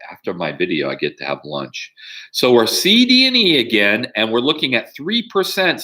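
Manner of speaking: normal talking voice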